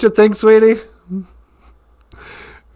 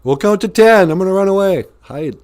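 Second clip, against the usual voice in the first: put-on much higher kid shouting at play; kids playing guns; 8 semitones higher, 3 dB louder than usual. Kindergartners playing guns in the yard, shouting and high. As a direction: yell like a kid playing cops and robbers. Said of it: "'cause you're constantly playing guns".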